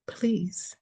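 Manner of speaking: breathy whisper, just the word; whispered plea. Acted. Reader begs the viewer, whispered. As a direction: plead in a hush.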